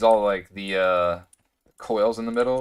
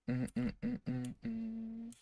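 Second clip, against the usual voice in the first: humming; 12 semitones higher, 12 dB quieter than usual. Real, not acted. Hums while waiting for machine.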